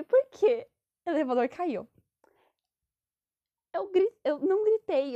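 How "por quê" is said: sudden high alarmed "Por quê?"; a kid suddenly alarmed as the ride drops; startled question. Retold or imitated. Daughter asks her father why, alarmed and high.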